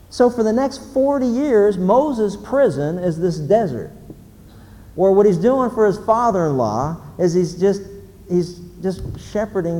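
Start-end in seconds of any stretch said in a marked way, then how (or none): none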